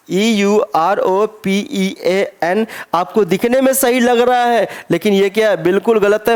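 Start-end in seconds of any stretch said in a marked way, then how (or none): 0.1-2.7 letter by letter, slow; teacher spelling a word slowly for the class; spelled out slowly. Shown as a demonstration. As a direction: spell each letter deliberately.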